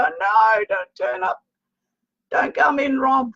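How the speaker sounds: high, mock-alarmed shout of protest; hammed-up cry to the spirit not to come in. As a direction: playfully yell at him to stay away. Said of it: "I go".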